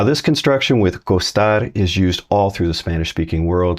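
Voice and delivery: usual speaking voice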